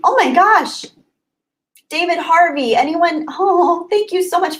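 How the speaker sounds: excited. Real, not acted. Excited at spotting a super chat.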